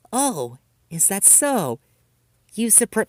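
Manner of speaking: false honeyed tone; fake sweetness. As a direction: keep up the sweet act. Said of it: "faked her kindness".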